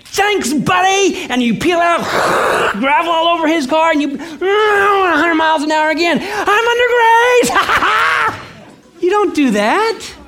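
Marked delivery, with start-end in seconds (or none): 0.0-1.2 high, loud, slow mock shout of thanks; hammed-up smug thank-you yelled high; cocky thanks. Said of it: "you say". 4.1-8.4 high, loud laughing gloat; hammed-up laughing shout, slow and high; mock gloating. Mock reckless driver gloats.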